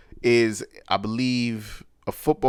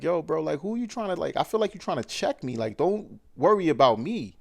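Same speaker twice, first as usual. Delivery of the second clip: heated comeback; 6 semitones higher than usual. Retold or imitated. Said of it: "it gets a little heated".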